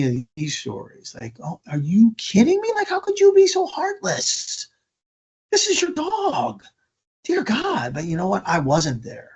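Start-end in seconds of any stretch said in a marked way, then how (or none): 1.4-7.9 very high-pitched, incredulous outburst; mock-furious scolding in a soaring voice; outraged rant. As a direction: let indignation shoot the pitch right up. Acts out imagined outraged confrontation.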